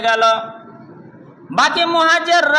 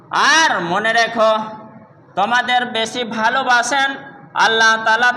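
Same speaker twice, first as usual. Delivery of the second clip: usual speaking voice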